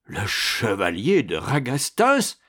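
slow, stunned repeat with a questioning rise; dumbfounded. A narrator's reading. Astorre sees the knight he thought buried, dumbfounded. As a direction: say the name as if seeing a ghost. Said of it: "stupide d'effarement".

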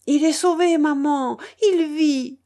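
high, loud joyful cry; excited relief. A narrator's reading. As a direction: cry out the good news to mother. Girl announces her brother lives. (cri de soulagement, aigu et fort)

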